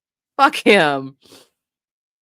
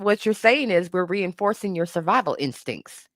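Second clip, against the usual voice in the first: usual speaking voice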